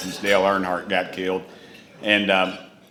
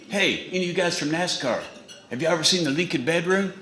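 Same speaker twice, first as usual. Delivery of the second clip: higher voice imitating the president; a hint of the president, higher; mock president; 6 semitones higher, 4 dB quieter than usual. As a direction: put on the president's voice.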